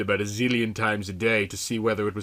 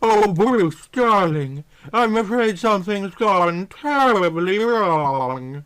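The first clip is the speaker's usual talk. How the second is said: higher, slow sultry purr; sultry woman's voice; 7 semitones higher, 3 dB louder, slower than usual. Acted. Computer voice apologizing to Bruce, sultry and slow. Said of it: "a woman's sultry voice".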